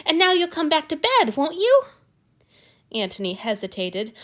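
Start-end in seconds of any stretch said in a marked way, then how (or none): 0.0-1.9 eager. A narrator's reading. Hanyu eager, hopeful.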